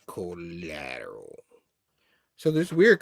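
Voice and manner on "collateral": slow eerie whisper; whispered repeat. Retold or imitated. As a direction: whisper it slowly, like a secret. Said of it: "And the guy's like".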